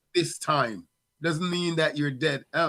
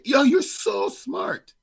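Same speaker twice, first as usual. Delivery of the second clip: high, slow hyped-up praise; drawn-out cheesy hype; mock praise; 12 semitones higher, 6 dB louder, much slower than usual. Dad mimicking the praise he never gives his kids. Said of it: "I will never say".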